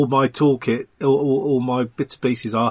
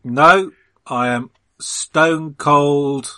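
slow deadpan; 3 dB louder, much slower than usual. Acted. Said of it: "You're not drunk".

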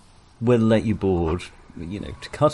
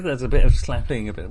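normal talking voice

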